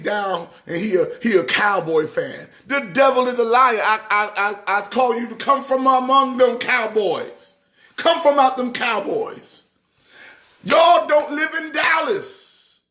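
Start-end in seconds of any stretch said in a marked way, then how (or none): 2.7-9.6 raised, preacherly call to come out; a hint of a pulpit rebuke; mock preaching. Mock preacher scolding Cowboys fans. 10.6-12.8 slow, high, shouted rebuke; playful preacher shout; mock shout. Shouted rebuke of Cowboys fans.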